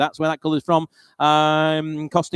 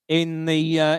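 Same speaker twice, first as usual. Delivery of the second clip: ordinary talk, nothing marked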